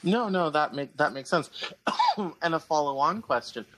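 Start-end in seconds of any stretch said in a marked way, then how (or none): 1.5-2.4 sharp sudden cough; coughing fit. Real, not acted. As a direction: cough hard mid-sentence. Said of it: "I'm sorry I'm coughing".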